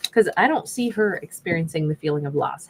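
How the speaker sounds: normal talking voice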